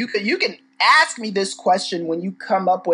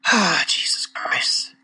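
exasperated whisper; 3 dB quieter, slower than usual. Real, not acted. Exasperated after reading her quote.

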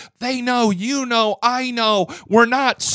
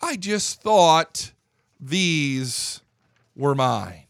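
slow mock repeat; 5 semitones lower, 3 dB quieter, much slower than usual. Repeats excuse slowly in mockery.

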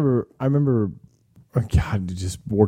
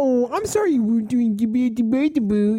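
much higher, sugary apologetic service voice; customer service voice; 12 semitones higher than usual. Retold or imitated. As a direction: chirp it out like a cheerful clerk. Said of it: "that octave higher than your normal human voice".

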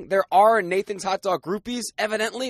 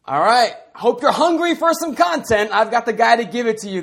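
excited host intro; 4 semitones higher, 5 dB louder than usual. Real, not acted. Hyped podcast guest intro.

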